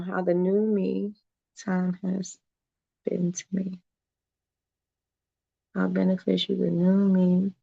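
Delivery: very soft, halting reread; reading to herself. Real, not acted. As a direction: puzzle it out under your breath. Puzzling over a misread comment.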